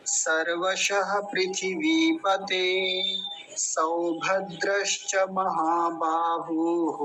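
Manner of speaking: slow even Sanskrit chant of the verse; flowing recitation. Shown as a demonstration. Teacher to students: slow flowing recitation of the verse. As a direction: recite the verse slowly, in one flow. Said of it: "प्रवाह से उच्चारण देखेंगे".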